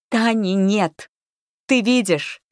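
loud, high, insistent cry; frantic shout. A narrator's reading. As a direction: shout it in her face to make her see. Frantic girl shouts at friend.

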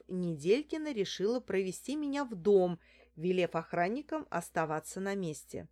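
ordinary delivery, nothing marked

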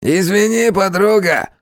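high, nasty distorted thug. A narrator's reading. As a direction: sneer it in a twisted voice. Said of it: "противным искажённым голосом".

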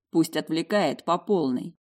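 ordinary delivery

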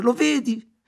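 high, loud and tearful; sobbing. A narrator's reading. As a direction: cry the words out through sobs. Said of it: "singhiozzando e continuando a ripetere".

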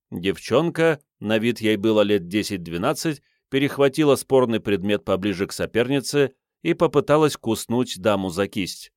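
ordinary delivery

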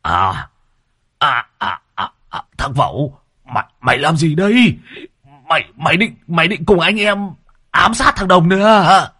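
stammering start climbing to a high accusation; rising, aghast stammer; horrified outcry. A narrator's reading. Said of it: "rú lên kinh hãi".

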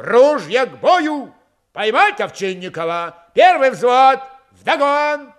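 high, ringing, loud orders; shouted battle commands. A narrator's reading. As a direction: fire off orders to the whole troop. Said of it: "Фомин скомандовал".

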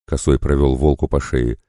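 usual speaking voice

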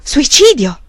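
incredulous, rising question of horror; horrified question. A narrator's reading. The prior repeats the word in horror, disbelieving.

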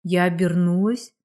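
ordinary delivery

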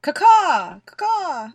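loud cawing like a raven. Pretend. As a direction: caw out like a crow, high. Imitating ravens for sound effects.

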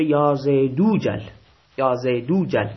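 یا زیدو جل joined as "ya Zay-doo-jal"; vav read as u. Shown as a demonstration. Teacher showing how it is read mid-sentence, long oo before jal. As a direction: link زیدو to جل with long oo, skipping the hamza.

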